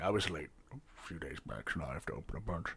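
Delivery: a hint of a low, soft bartender voice; quiet, murmured innkeeper talk; lightly voiced bartender.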